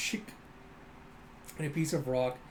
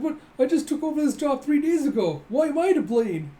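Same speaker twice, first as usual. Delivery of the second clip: high, loud wail of unfairness; hammed-up aggrieved outburst; acted indignant protest; 10 semitones higher, 8 dB louder than usual. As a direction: protest loudly at being blamed.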